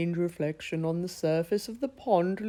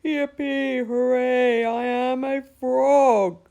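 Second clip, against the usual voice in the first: higher, much louder slow cheer; 7 semitones higher, 8 dB louder, slower than usual. Acted. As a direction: shout it out in triumph. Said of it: "cheering and laughing".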